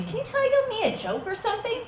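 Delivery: high, fast chatty waxer voice; bubbly beautician chattering on; chirpy waxer. Retold or imitated. Waxer chatting brightly to client on the table. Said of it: "So she's like".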